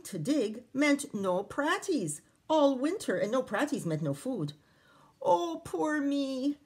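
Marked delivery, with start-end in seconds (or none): 5.2-6.6 high, slow self-pitying wail; drawn-out poor-me lament. Acted. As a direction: moan it out, sorry for himself. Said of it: "wailed Jamie".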